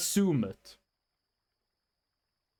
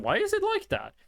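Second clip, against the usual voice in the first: puzzled; 10 semitones higher than usual. Retold or imitated. Puzzled at monitor settings.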